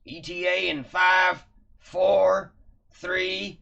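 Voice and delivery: loud barking countdown; shouted countdown. Acted. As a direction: bark out the seconds. Countdown called on a radio.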